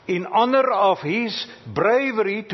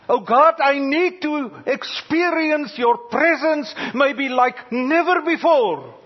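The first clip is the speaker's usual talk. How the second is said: pleading shout; 7 semitones higher than usual. Retold or imitated. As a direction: call out to God, voice lifted high and urgent. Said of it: "we shout".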